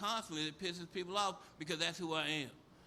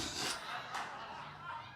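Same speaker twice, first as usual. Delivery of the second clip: laughing; 8 semitones higher, 8 dB quieter than usual. Real, not acted. Laughs at his own joke.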